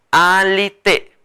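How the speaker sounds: alite drawn out long; very slow modeled word. Shown as a demonstration. Teacher models the word slowly for students. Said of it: "Así".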